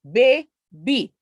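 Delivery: put-on "Baby" as bay, gap, bee; split into syllables. Shown as a demonstration. Teacher asking how many syllables, "Baby" split in two. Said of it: "there are how many syllables?".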